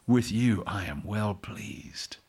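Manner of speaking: slow, soft whisper; whispered voice of the Lord. Acted. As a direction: whisper it slowly, as God speaking at dawn. Voice of the Lord speaking.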